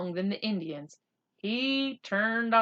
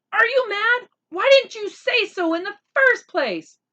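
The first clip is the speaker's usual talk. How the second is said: exasperated; 14 semitones higher, 10 dB louder than usual. Acted. Boy turns on friend, exasperated.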